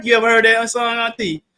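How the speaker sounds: ordinary talk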